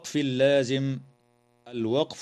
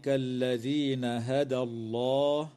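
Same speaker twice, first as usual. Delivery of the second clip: Quranic recitation; 3 dB quieter than usual. Shown as a demonstration. Quran verse recited in tajweed.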